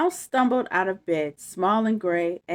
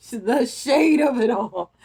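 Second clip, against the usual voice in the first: laughing; 5 semitones higher, 8 dB louder than usual. Real, not acted. Laughing comment on the mouse.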